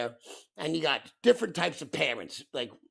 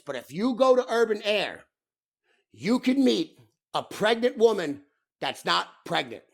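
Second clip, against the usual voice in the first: emphatic announcement; 5 semitones higher, 5 dB louder than usual. Real, not acted. Emphatic claim, repeated for effect.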